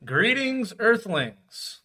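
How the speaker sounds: high mock alien greeting. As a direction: greet the humans like a visitor from space. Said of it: "Going straight for the Earthlings".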